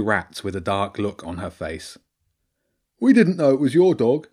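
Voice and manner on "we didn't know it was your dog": high, loud defensive rat; Bobby. Acted. Rat excuses himself to angry girl, high loud protest. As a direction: protest innocence in a raised rat voice.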